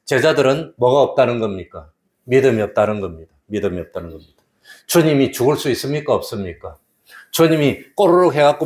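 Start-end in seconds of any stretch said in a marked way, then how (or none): 3.5-4.3 믿음이 없다는 겁니다 repeated lower and much softer; a hushed echo of the line. Acted. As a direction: let the repeat fall away quietly. Repeated key sentence in sermon.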